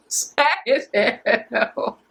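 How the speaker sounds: very drawn-out words through laughter; laughing. Real, not acted. Host mocking Minnie's face at the snub, laughing. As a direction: crack up while dragging it out.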